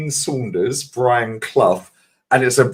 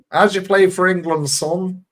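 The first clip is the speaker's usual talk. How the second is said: mimicking a football manager's accent, pitched higher; a higher, slow Clough-style northern accent, quizzing the player; Brian Clough accent; 7 semitones higher than usual. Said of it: "nails his fucking accent".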